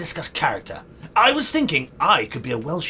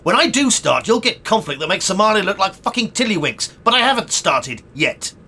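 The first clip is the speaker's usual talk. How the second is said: loud, raised, sweary tirade; angry rant; 5 semitones higher, 5 dB louder than usual. Acted. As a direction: build a furious protest, easing down in pitch. Ranting at director that nothing began.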